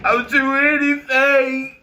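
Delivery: high, slow whiny "I'll do anything"; mock desperate plea. Mocking eager followers in a high whiny plea. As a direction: whine it like a begging fan.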